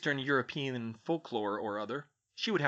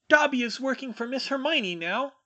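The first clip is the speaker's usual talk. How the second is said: very high, loud little elf voice; defiant elf; 12 semitones higher, 6 dB louder than usual. A narrator's reading. Elf to his old masters, high loud defiance. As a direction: squeak it out, standing your ground. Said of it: "stood his ground defiantly and said".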